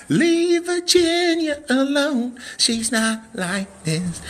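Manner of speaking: high, slow put-on teasing voice; mock voice. Seller teasing a viewer from Virginia, mock voice. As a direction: tease in a silly drawn-out character voice.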